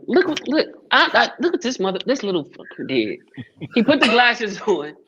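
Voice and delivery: laughing hard while telling it; cracking up mid-sentence; laughing at his son. Real, not acted. Laughing at son's trick.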